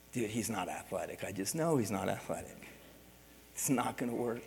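put-on thinking it silently while the kid asks to play; inner thought. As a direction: say it under your breath to yourself.

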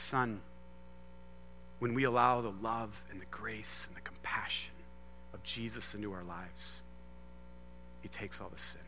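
soft, near-whispered fatherly words; gentle hushed father's lesson; hushed retelling of father. Retold or imitated. Father's tender words in story.

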